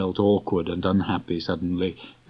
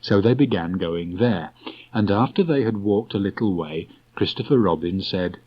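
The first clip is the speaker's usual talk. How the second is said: ordinary delivery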